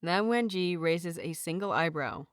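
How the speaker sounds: ordinary delivery, nothing marked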